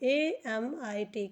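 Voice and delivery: letter by letter, drawn out; slow spelling. Shown as a demonstration. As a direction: spell A M I T slowly, one letter at a time. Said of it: "spelling letter by letter". (अक्षर अक्षर धीरे बोलना)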